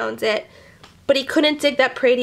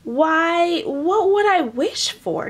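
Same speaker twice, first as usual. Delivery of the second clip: asking; 6 semitones higher, slower than usual. Acted. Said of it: "Jamie asked".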